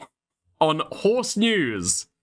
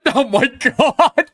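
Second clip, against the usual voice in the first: laughing disbelief, high and loud; cracking up in disbelief; 7 semitones higher, 5 dB louder than usual. Real, not acted. Disbelief at her video.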